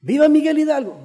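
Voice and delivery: high, ringing rally call; imitated grito. Stand-in giving the grito to the town, acted shout. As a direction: call out the hero's name as if from a tribune. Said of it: "empieza a gritar".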